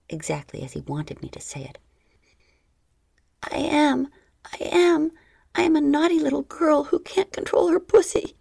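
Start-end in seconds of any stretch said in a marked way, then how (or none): 3.4-8.3 high tearful crying confession. Acted. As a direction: say it while crying, pitched up.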